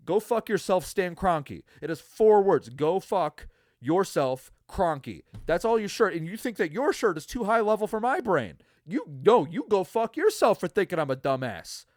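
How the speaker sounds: loud, high indignant rant; fuming at the shirt guy; angry rant. Real, not acted. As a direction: build into outraged disbelief. Ranting about shirt guy.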